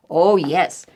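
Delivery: normal talking voice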